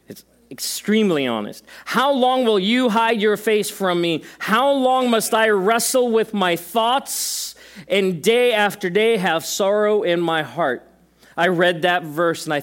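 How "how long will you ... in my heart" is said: raised, slowed lament reading; dramatic psalm reading. Acted. Preacher reading psalm thirteen to the church, pleading. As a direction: read it as heartfelt pleading.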